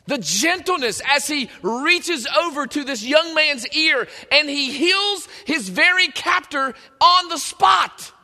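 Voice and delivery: excited preaching. Real, not acted. Preacher to congregation on meekness, swelling with excitement. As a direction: preach it hard, let it swell and push. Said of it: "Sorry, got a little excited on that one".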